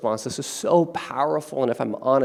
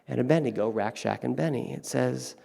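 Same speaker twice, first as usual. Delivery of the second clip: ordinary talk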